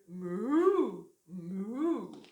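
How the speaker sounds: playful cow moo. Acted. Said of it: "who muttered".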